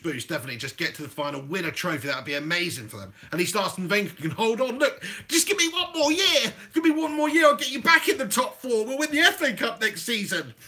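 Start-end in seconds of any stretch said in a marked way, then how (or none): 4.8-10.5 high, hammed-up pleading; mock desperate begging; begging manager. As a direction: plead for one more chance, pitched up. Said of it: "He wouldn't be begging them".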